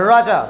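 राजा starting with tongue curled far back; heavy curled r opening राजा; retroflex r. Shown as a demonstration. Teacher repeating the corrected word, retroflex राजा. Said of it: "लेकिन ये होता क्या है".

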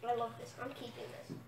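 quiet. Real, not acted. Eating baby food.

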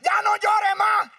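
slow, high, loud shout; husband shouting. Retold or imitated. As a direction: shout it at the crying wife. (el marido gritando despacio)

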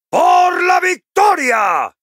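very high, long victory shout; battle cry. Acted. Dwarf rallying comrades to victory, soaring battle cry. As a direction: hurl it out to rally the troops.

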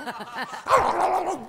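loud, high mauling noise; imitated dog attack. Storyteller acting out the dog on his cousin, savage.

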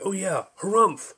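ordinary delivery, nothing marked

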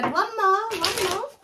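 high, airy voice of the spirits. Retold or imitated. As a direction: sing it up high like a spirit messenger. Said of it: "Spirit said".

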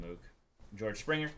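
normal talking voice

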